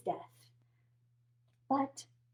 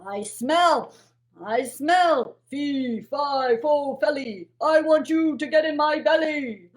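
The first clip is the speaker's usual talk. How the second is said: louder booming giant chant; 6 dB louder than usual. Acted. Giant threatening to eat Jack, loud and booming. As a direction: boom it out like a hungry giant. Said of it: "he sniffed".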